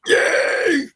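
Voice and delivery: a quick, high, breathy yay of delight; airy little squeal of joy; excited cheer. Real, not acted. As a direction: cheer it like great news just landed. Cheering the Saturday schedule change.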